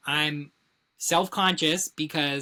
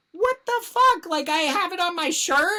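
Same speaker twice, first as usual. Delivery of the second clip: much higher, louder panicked squeal; mock panicked squeal; 14 semitones higher, 4 dB louder than usual. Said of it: "I was like".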